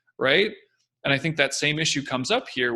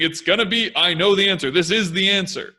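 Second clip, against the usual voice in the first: certain, a bit raised and louder; confident voice; 4 semitones higher, 6 dB louder than usual. Shown as a demonstration. Expert telling the public when the virus ends, with total certainty. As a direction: sound like you already know the answer. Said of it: "But my voice is saying".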